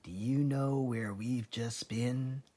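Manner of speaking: dark secret whisper; whispered darkly. A narrator's reading. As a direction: lean in and hush it darkly. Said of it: "whispered darkly".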